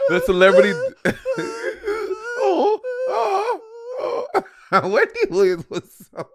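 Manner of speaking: high squealing laughter, gasping oh; helpless laughter. Real, not acted. Laughing at her own joke about Wendy, squealing. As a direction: laugh until it hurts.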